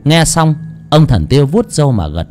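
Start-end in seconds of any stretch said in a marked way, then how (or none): none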